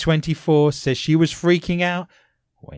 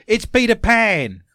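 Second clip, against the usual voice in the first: louder, higher announcer's flourish; dramatic proclamation; 8 semitones higher, 6 dB louder than usual. Pretend. Host sums up the ghost story, dramatic flourish.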